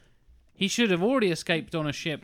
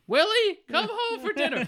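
high shrill mom calling her son; mother calling out. Retold or imitated. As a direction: call him home like a mother.

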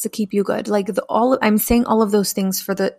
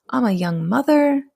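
normal talking voice

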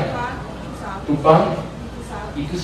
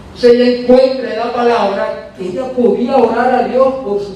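higher, fervent preaching voice; 9 semitones higher than usual. Acted. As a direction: preach it out to the congregation. Preacher raises voice in testimony.

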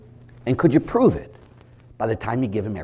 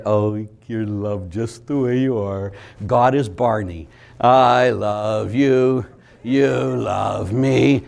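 mock sugary priest; 2 semitones lower, much slower than usual. Lazy priest cooing at the parish, mock sweet voice. Parodying soft priest at Mass.

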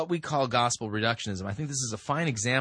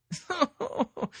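high, light descending laugh; mocking laugh. Real, not acted. Host scoffing at a written apology, high mocking laugh. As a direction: laugh high at what you just read.

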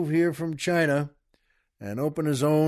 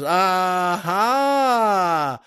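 long, swooping gotcha cry; hammed-up moment of discovery; triumphant aha; 2 semitones higher, 5 dB louder than usual. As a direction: catch them out with a drawn-out aha. Lawyer thinks he caught the trick.

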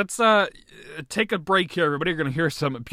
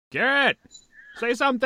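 calling out; 8 semitones higher, 5 dB louder than usual. Real, not acted. Calling to a muted guest.

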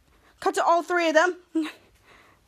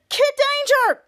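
high acted call to a hero; raised, high-pitched mock shout; 8 semitones higher, 4 dB louder, slower than usual. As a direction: imitate calling someone's name urgently. Acted hero calling out a name.

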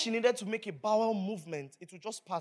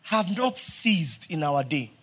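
loud, fervent sermon delivery; full-force preaching; 3 semitones higher, 13 dB louder than usual. Acted.

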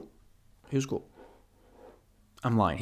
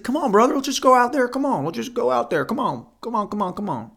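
much higher, coaxing friendly voice; nice guy Dean; 11 semitones higher than usual. Retold or imitated. Dean urging his friend to the next bar, high coaxing voice. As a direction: coax your buddy along, high and eager. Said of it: "being the nice guy he is".

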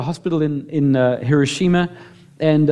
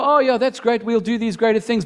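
mock casual agreement, higher; complacent shrug; 7 semitones higher than usual. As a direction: lightly mimic a lazy churchgoer. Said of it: "we go".

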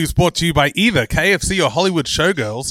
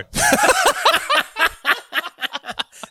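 high burst of laughter. Real, not acted. Laughing after his hello bit.

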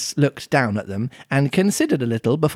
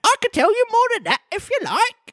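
very high squeaky voice; Griffin. A narrator's reading. Griffin's reply.

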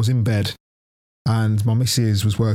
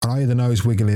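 ordinary talk

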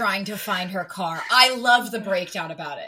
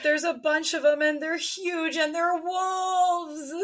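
mock dramatic; 9 semitones higher, slower than usual. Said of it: "She's like".

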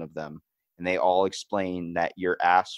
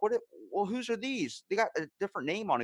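indignant question; 9 semitones higher, faster than usual. Retold or imitated. Questioning grandma's gifts.

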